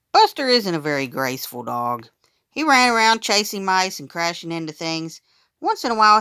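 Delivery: ordinary delivery, nothing marked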